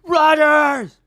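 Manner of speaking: reliving the crazed Rodgers yell for the camera; crazy yell. Retold or imitated. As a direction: scream Rodgers like you lost it. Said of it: "I sounded crazy".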